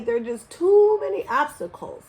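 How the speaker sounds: ordinary delivery, nothing marked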